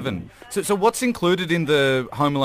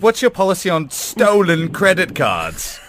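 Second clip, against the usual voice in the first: louder, slow mock question; loud joking shout; 8 semitones higher, 7 dB louder, slower than usual. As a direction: blurt the cheeky joke loudly. Joking at the hotel.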